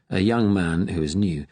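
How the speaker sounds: usual speaking voice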